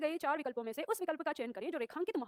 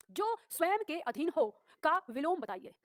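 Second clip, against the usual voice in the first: slow question reading; 2 semitones higher, 3 dB louder, slower than usual. Shown as a demonstration.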